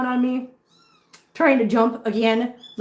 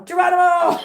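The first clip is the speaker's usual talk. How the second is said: mock battle cry; 8 semitones higher, 6 dB louder than usual. Voicing kitten jumping off.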